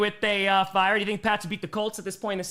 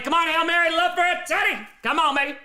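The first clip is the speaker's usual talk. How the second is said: urgent pleading; 8 semitones higher, 4 dB louder than usual. Real, not acted. Pleading for a big play.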